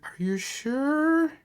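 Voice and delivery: high, slow, timid question; frail hesitant. Acted. Heather doubting Joseph, high and timid. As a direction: ask it fearfully and drawn out. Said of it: "She's like".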